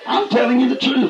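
normal talking voice

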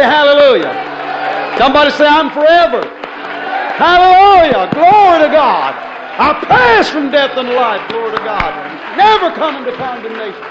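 loud, high shouted preaching; full-force preaching. Real, not acted. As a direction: shout it out to rouse the congregation.

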